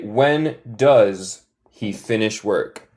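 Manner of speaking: "When does he finish work?" at half speed; slow model question. Shown as a demonstration. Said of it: "se dice".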